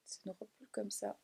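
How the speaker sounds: breathy whispered aside. Real, not acted. Vlogger whispering the item name to herself. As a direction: whisper it to yourself, no voice.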